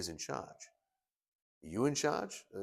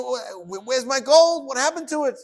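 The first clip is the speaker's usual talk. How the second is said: high, loud frantic shout; panicked cry; 13 semitones higher, 11 dB louder than usual. Acted. Brother finds his buried gold gone, frantic panicked shout. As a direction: dig in a frenzy and yell for the gold. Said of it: "and he's in panic".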